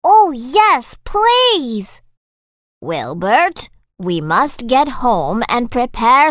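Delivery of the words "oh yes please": high, eager village mother voice. Acted. Missus Tweedy gladly accepts the soup, high eager voice. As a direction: pitch up as a keen village mum.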